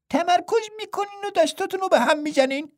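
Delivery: high, light twin voice finishing his brother's line; a playful twin monk pitched well up. A narrator's reading. Twin Pang adds. (صدای زیر و سبک راهب دوقلو)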